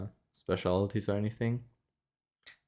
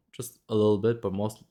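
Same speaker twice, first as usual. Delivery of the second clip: ordinary talk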